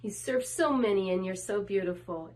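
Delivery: ordinary talk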